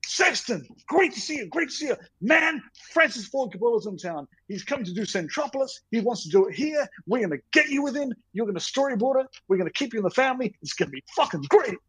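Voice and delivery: high-pitched, rapid-fire producer pitch; put-on excited Hollywood producer; Rick McCallum, gushing. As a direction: hype it up without stopping, voice way up high. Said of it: "He went".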